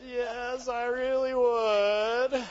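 drawn-out whiny yes, vowels stretched; mock whining. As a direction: whine it out slowly, hammed-up. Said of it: "it's a whining yes".

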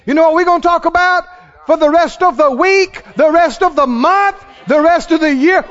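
loud, high preaching shout, building; full-force preaching. Acted. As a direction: rouse the congregation to praise. Preaching climax.

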